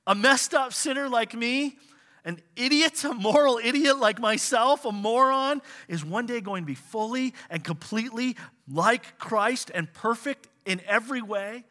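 high, loud, wide-eyed wonder; amazed exclamation. Retold or imitated. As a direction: burst out in disbelieving awe. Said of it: "and we go".

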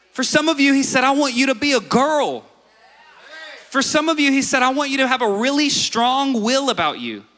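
high, emphatic preaching; full-force preaching. Acted. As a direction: drive each line home to the congregation.

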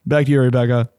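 a hint of an anchor sign-off; news anchor voice. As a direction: toss it back to the studio. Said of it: "still using his news anchor voice".

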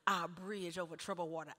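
lightly imitated praying pastor, low and measured; a hint of a solemn pastor at prayer; pastor's prayer. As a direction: recite a solemn prayer with pauses, voice dropped. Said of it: "and he would say".

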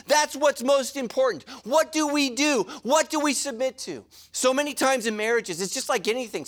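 ordinary talk

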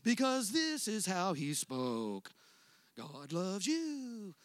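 slow, lilting mock preacher voice; imitating a sing-song preacher; sing-songy preacher. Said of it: "speak in a very sing-songy voice".

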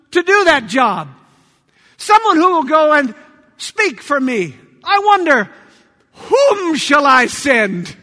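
loud, commanding voice from the throne; powerful voice of God. Acted. God calls from his throne for a messenger, powerful voice. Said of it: "says in his powerful voice".

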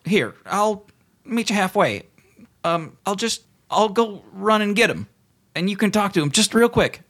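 high, hesitant minion voice; high-pitched, halting comic underling; underling officer. Acted. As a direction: offer a compromise in a high little voice. Underling officer offers a compromise.

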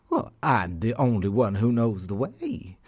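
ordinary delivery

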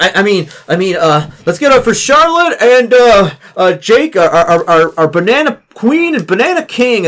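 high, loud stammering announcer voice; student president crowning the wrong pair; flustered announcer. Acted. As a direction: stumble through the crowning. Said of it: "and she's like".

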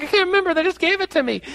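put-on high kid voice. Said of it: "he's like".

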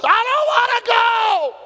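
high, long-held acted cry; anguished refusal. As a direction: wail the refusal at full voice. Acts out Jesus refusing the cross.

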